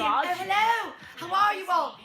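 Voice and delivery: bright greeting pitched high and loud; imitating a cheery dainty professor; happy, dainty professor. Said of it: "She's, like, happy and".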